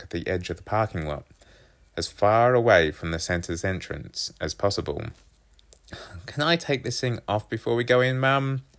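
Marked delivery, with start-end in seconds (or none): 6.3-8.6 put-on raised boy's voice; pleading son. A narrator's reading. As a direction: ask Mum for permission, pitched up. Boy pleads with mum.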